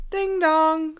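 high sung ding dong; doorbell sound. Shown as a demonstration. Doorbell effect, high chimed ding dong. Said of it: "If the doorbell rings".

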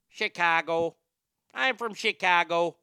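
hammed-up Chicago accent; put-on Chicago accent demo. Shown as a demonstration. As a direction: say it like a proud Chicago local. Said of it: "do the Chicago accent like that".

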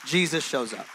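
loud, punched JESUS, falling close; emphatic declaration. Real, not acted. Pastor telling congregation about darkest moments, emphatic declaration. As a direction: proclaim it to the room with force. Host declares, then repeats it softer.

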